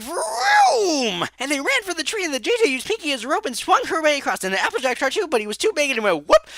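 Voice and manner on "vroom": high, stretched vroom; mock engine vroom. Filly acting out the cousin running, playful vroom. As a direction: zoom past like a speeding racer. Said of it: "Cousin J J was all like".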